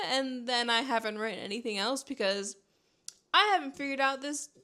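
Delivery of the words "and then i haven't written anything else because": usual speaking voice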